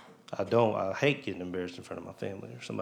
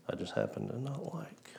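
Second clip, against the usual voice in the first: whispered aside; 11 dB quieter than usual. Real, not acted. Confessing dislike of a coworker.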